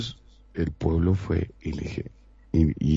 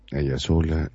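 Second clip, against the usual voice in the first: ordinary talk, nothing marked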